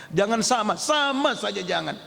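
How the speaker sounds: usual speaking voice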